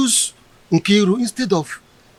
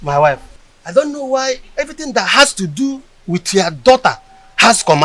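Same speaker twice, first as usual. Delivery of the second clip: ordinary talk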